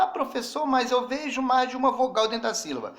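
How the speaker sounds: teacher mimicking a student who objects; imagined student objection. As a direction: play the doubting pupil, pitch up and rush it. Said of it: "Aí você fala".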